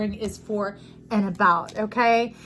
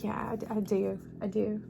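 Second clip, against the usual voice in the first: softer, bashful answer; soft shy reply; 7 dB quieter than usual. Pretend. Reader softly answering the spirit, bashful. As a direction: say it quietly, a little shy. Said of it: "And you're like".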